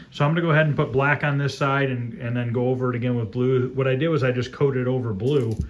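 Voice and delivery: normal talking voice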